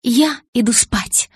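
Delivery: deliberate, emphatic statement; firm announcement. A narrator's reading. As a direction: announce it word by word. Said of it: "заявила я".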